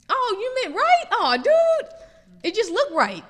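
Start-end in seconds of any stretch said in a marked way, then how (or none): 0.1-1.9 high, loud, dawning oops; mock surprise. Driver realizes the wrong turn, high surprised cry. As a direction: act caught out, pitched way up.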